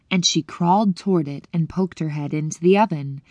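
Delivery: usual speaking voice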